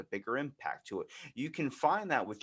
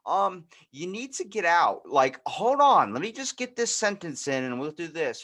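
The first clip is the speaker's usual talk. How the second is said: mock fire alarm; 9 semitones higher, 9 dB louder than usual. Warning a writer about the fire, loud and frantic. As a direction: shout that the house is burning. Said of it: "It's like".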